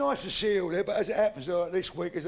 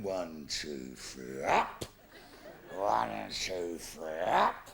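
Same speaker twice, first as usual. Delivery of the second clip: heaving count; 11 semitones lower, much slower than usual. Retold or imitated. Mate heard through the wall.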